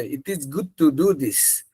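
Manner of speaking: very slow Middle Eastern English; deliberate Middle Eastern English line; Middle Eastern accent. Retold or imitated. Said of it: "middle ইস্টে যায় তখন কি বলে".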